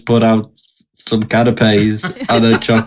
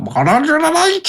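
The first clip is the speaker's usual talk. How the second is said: hammed-up darts commentator, pitched way up; darts caller. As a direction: call a maximum like a darts referee. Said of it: "He'll start talking about the darts".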